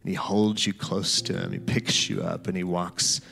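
guided scripture meditation. Acted.

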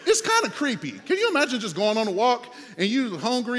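ordinary talk

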